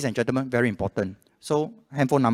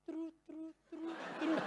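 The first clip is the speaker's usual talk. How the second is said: high, soft imitation of a transfer tone; mouthed ringing tone; 18 dB quieter, slower than usual. Scammer faking the call transfer sound, high and soft. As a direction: trill it like a faked phone sound. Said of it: "They make the sound literally like".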